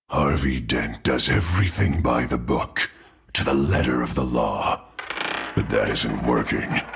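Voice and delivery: low, cold second-personality voice; a low menacing inner voice; sinister alter ego. Acted. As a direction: let a darker self take over the mouth. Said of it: "You're acting strange, Harvey".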